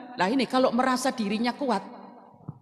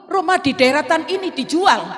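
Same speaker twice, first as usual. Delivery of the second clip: excited discovery; 7 semitones higher, 8 dB louder than usual. Retold or imitated. Retelling spotting the house for sale.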